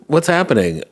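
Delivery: ordinary talk, nothing marked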